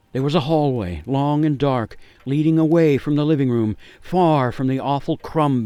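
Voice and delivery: breathless storyteller build; building tension. A narrator's reading. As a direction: push up the excitement as hope appears. Tense escape in story narration.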